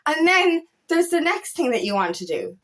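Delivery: ordinary delivery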